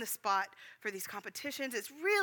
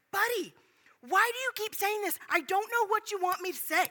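higher, louder exasperated outburst; raised voice, mad; 8 semitones higher, 4 dB louder than usual. Retold or imitated. Retelling getting mad at Buddy in the Kroger, raised voice. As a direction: raise your voice in angry frustration. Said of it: "I raised my voice".

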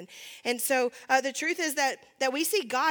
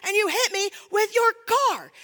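inner rant; 6 semitones higher, 4 dB louder than usual. Retold or imitated. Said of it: "in my mind, I'm like".

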